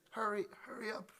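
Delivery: high mock nagging, not truly shouted; nagging heckler. Lady nags the musician every two minutes, high mock-heckling voice. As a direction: imitate a repeated high-pitched hurry-along. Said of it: "Starts shouting".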